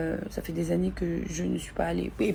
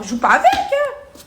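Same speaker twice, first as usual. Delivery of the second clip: high exclamation; 7 dB louder than usual. Real, not acted. Exclaims about the disgusting situation.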